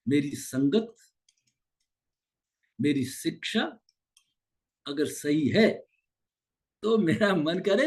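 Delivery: ordinary delivery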